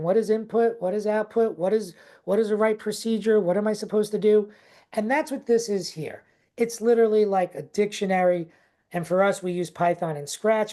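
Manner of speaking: normal talking voice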